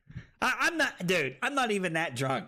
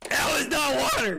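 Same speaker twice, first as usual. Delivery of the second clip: outraged yell; 9 semitones higher, 4 dB louder than usual. Real, not acted. Outraged after tasting drink.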